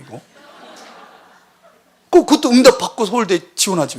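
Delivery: normal talking voice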